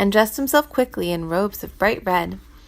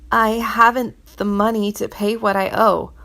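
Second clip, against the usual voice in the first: usual speaking voice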